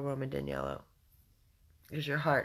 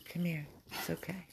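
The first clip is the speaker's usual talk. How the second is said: normal talking voice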